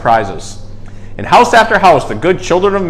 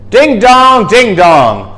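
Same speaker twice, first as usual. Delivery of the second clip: bell chime; 5 semitones higher, 5 dB louder, much slower than usual. A narrator's reading. Church bells again.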